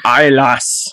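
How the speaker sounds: half-hearted Scottish brogue; badly faked Scottish lilt; attempted Scottish accent. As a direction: try a rough Scottish accent. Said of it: "I can't do the accent".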